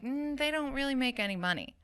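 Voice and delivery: a hint of an unsupportive mother. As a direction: brush off the dream like a doubting mum. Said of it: "I sound like someone's, like, unsupportive mother".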